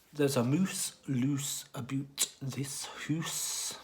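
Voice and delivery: slow, rolled Scottish saying; Scottish accent. Shown as a demonstration. Quoting a Scottish saying to the audience, slow broad Scottish accent. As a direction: savour each Scottish vowel slowly. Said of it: "is a well-known saying in Scotland".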